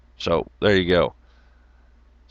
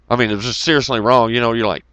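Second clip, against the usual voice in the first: ordinary talk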